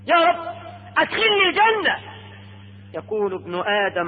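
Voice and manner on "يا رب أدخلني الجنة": high-pitched imploring cry; pleading. Acted. Man begging God to admit him to Paradise, imploring.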